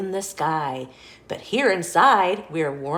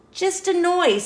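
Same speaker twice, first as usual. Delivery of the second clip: higher, brave little pig; 11 semitones higher, 4 dB louder than usual. A narrator's reading. Pig bravely shrugging off the thunder, higher. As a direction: dismiss it like a small pig.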